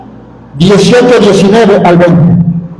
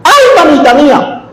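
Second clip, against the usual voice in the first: mock cry of pain; 8 semitones higher than usual. Mock pain cry when burned.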